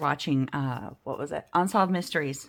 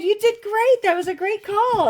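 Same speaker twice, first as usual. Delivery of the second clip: much higher and louder, chirpy praise; customer service voice; 14 semitones higher, 7 dB louder than usual. Retold or imitated. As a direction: gush like a cheerful phone agent. Her own phone voice, praising.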